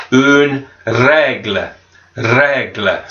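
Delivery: une règle with a rolled R and open E; French pronunciation. Shown as a demonstration. Teacher modelling une règle slowly for pupils. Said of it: "ruler en français is".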